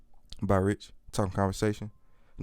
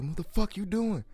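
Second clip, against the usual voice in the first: retold angry yell; 11 semitones higher than usual. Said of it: "She like".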